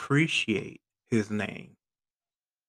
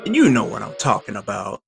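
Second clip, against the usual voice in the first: louder fast knowing remark; loud quick aside; 9 dB louder, faster than usual. Real, not acted.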